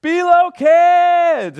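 long, high, full-volume holler; yelled summons. Shown as a demonstration. Dad calls all the kids to come, yelling. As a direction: call through the whole house, stretching the words. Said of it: "I just yell out".

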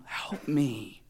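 whispered plea; hushed, acted supplication; begging. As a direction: breathe it out as a desperate whisper. Woman kneels and begs.

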